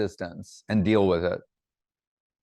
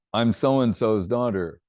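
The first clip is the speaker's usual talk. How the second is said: lightly voiced daughter; slower than usual. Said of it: "she goes".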